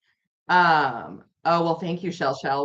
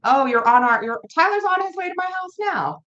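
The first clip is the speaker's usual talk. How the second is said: much higher, surprised outburst; 12 semitones higher, 4 dB louder than usual. Real, not acted. Host learns a friend is on his way over, surprised. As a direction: blurt it out, pitch leaping up.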